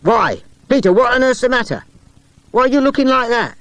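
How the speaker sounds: high, loud astonished voice. A narrator's reading. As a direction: gasp it out, amazed. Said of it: "looked suddenly astonished".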